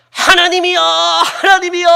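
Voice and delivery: acted anguished bellow; wailing cry. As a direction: cry out desperately, stretching the last syllable. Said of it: "막 울부짖지 않았겠습니까".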